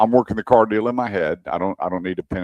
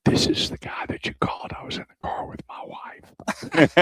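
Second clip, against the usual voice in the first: furtive whisper; 7 dB quieter than usual. Retold or imitated. Husband confides to the car adviser about his wife, furtive whisper. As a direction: whisper so nobody overhears.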